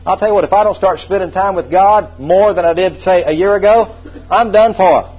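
fervent pulpit declaration; full-force preaching. Real, not acted.

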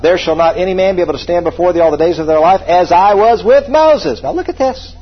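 forceful scripture preaching. Real, not acted. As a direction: hammer out the verse to a congregation.

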